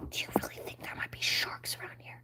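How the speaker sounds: hushed unvoiced whisper. Ariel and Flounder whispering in the shipwreck. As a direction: whisper in the dark wreck.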